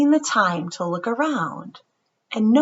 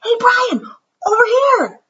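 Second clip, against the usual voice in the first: high, loud call; shouting; 7 dB louder than usual. Acted. Justin calling Brian to come sit with them, high and loud. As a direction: wave him over with your voice. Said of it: "he hears someone shout".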